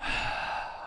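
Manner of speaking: long soft breath out, unvoiced; deep breath out. Shown as a demonstration. Showing the sumo stance breath, a long slow exhale. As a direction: exhale slowly and settle. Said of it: "taking a deep breath".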